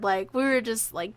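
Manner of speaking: ordinary talk, nothing marked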